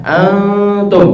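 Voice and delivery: "antum" very slow, the n hummed long and high; slow ikhfa demo. Shown as a demonstration. Teacher slowly models "antum" with long ghunnah. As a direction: stretch "antum" so the nasal dominates. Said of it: "Coba baca sama-sama".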